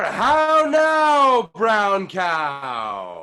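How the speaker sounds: How now shouted high, brown cow still loud; full shouting warmup. Shown as a demonstration. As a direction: project it to the back wall. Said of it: "even louder and bigger".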